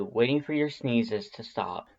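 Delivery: ordinary talk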